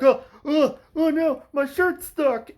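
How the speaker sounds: high, loud, whiny panic; mock dim son. Eldest son stuck on a sharp object, in whiny mock panic. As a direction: whimper it out like a helpless boy. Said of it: "He's like".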